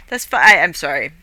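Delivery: usual speaking voice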